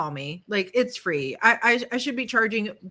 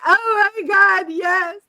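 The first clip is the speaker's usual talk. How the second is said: high, loud, slow oh my God; relieved outburst; 10 semitones higher, 7 dB louder, slower than usual. Retold or imitated. New mother answering the hairdresser, loud relief. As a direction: burst out in grateful relief. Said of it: "And I was like".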